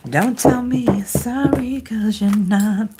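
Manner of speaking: slow, deliberate put-on delivery of a retort; slow, drawn-out reply. Reader voices Leo's reply to an apology, slow and drawn out. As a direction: stretch the words out slowly.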